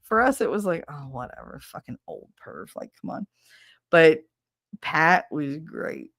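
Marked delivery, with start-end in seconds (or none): 0.9-3.3 put-on whispered, dismissive teen shrug; bored whisper. Teens whispering scorn about the creepy teacher. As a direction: brush it off under your breath.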